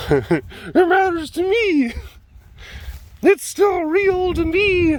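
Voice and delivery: high, slow, wailing mock-tragic voice; mock melodrama. Vlogger mocking herself with tragic wailing. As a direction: ham up the heartbreak, stretched out. Mock dramatic self-pity.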